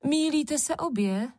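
mild high-pitched exclamation; Červenka exclaiming. A narrator's reading. One sister contradicts the other two, mild exclamation. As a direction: cut in with a lightly called correction. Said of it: "zvolala Červenka".

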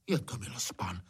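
soft, relieved murmur; relieved sigh. A narrator's reading. Gamekeeper recognises the master, soft relief. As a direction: let the breath out, dropping the volume. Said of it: "oddechl si Hajný".

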